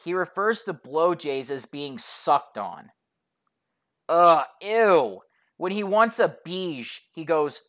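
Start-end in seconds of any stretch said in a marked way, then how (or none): 4.1-5.2 drawn-out groan of disgust. Real, not acted. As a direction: recoil with a long "ew". Disgust at his wording.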